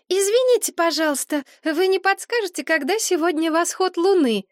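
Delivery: put-on lively young woman, pitched high; high girlish voice. A narrator's reading. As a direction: voice her bright and high. Maria's voice in the story.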